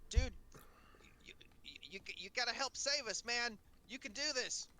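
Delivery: high, breathy little fairy; tiny tinkling fairy voice. Acted. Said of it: "Fairy voice, man".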